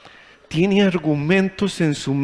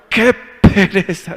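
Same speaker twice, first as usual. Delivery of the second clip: slow, louder, higher drawn-out groan of reluctance; lazy grumble; 6 semitones higher, 6 dB louder, slower than usual. Retold or imitated. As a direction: drag it out like you can't be bothered. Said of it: "decía yo".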